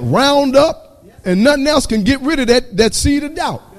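whooping preacher rising in pitch; preaching at full force. Acted. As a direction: build the sermon up to a sung, fervent cry.

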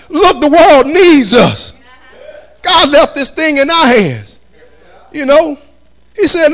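exhorting the church with drawn-out key words; full-force preaching. Real, not acted. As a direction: preach it out to the congregation, high and strong.

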